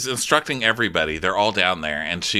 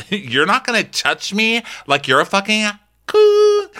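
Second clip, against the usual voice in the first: higher, loud bratty defiance; imitating a teen telling off a principal; mock defiant outburst; 11 semitones higher, 4 dB louder than usual. [name] retelling telling off her principal.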